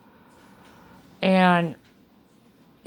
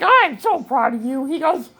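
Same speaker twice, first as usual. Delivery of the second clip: voicing proud surgeon; 7 semitones higher than usual. Patient quoting her surgeon's praise, lightly acted pride. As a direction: lightly play the beaming surgeon.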